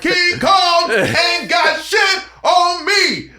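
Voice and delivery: slow, high, loud Training Day bravado; tough guy shout. Retold or imitated. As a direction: bellow it like a movie gangster.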